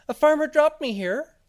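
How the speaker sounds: tiny, very high, loud little voice; talking seed. Acted. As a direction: squeak it out loudly like a tiny seed. Seed answers the cat.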